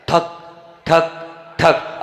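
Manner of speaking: slow, spaced-out imitation of a pestle thudding; pounding sound. Monk imitating the herb-pounding for listeners, slow thuds. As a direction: tap out each thud slowly like grinding medicine.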